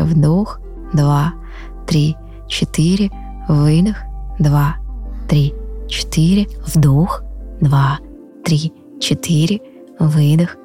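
soft, drawn-out counting of breaths; slow breath counting. Acted. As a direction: count the breaths slowly and calmly.

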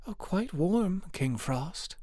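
high, timid little reply; shivering girl. A narrator's reading. Poor girl assuring King Frost she is warm, shivering. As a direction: answer small and high through a slight shiver. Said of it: "though she shivered as she spoke".

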